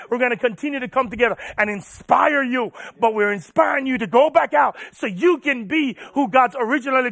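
full-force preaching. Real, not acted. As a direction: preach it hard, punching key words.

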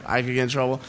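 normal talking voice

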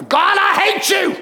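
mock furious shout at God; high, drawn-out acted yell of hatred; angry outburst. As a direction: imitate someone raging upward. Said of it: "so mad at God and so angry".

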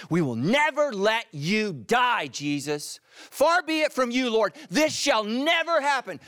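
high, loud, impassioned protest; Peter's fearful, insistent outcry; forceful rebuke. Acted. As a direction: rebuke him at full force, stretching the words. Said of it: "And so he rebuked Jesus".

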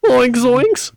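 high, cracking cartoon panic; Shaggy. Player giving a cartoon clue for guessing, high panicky voice. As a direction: yelp it like a scared cartoon slacker. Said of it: "I mean, Shaggy".

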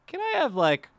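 high, slow, loud ask with a laugh; sheepish request. Retold or imitated. Ordering twenty sauces at McDonald's, high and sheepish. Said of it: "and I would say".

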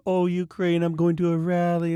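high-pitched mock enthusiasm; mocking imitation. Mimicking people who rally for every new cause, high and mocking.

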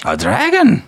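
high, loud startled question; surprised. Acted. Dwarf hears of a dragon, high and startled. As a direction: blurt it in disbelief.

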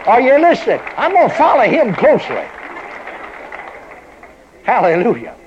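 loud, high fervent preaching. Acted. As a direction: preach it out with full fire. Preaching at full force.

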